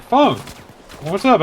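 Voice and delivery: imitated upbeat hello; retold surprised greeting. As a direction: greet him like an unexpected visitor. Principal greets boy he knows.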